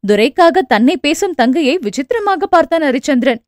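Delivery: plain reading voice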